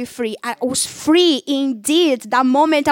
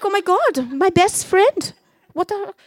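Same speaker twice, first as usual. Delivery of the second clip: shocked disbelief; 7 semitones higher than usual. Retold or imitated. Shocked at hang-up.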